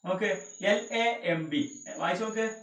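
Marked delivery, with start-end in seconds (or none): 0.6-1.8 slow, separated "എൽ", "എ", "എം", "ബി"; spelling out slowly. Shown as a demonstration. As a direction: spell "എൽ എ എം ബി" letter by letter. Teacher spells lamb for learners. ("എൽ എ എം ബി" പതുക്കെ ഓരോന്നായി)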